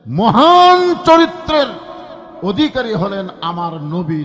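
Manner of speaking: fiery sermon peak; preaching climax. Real, not acted. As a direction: build up and proclaim.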